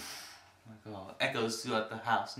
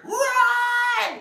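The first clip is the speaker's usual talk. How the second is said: very high, very loud screaming of the name; bloody murder scream; 12 dB louder than usual. Retold or imitated. Woman behind the apartment window, imitated high terrified scream. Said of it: "It's bloody murder".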